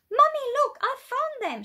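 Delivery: put-on a thrilled child squealing her find; shrieking. Molly spotting silver shoes in the charity shop, shrieking with joy. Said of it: "she shrieked".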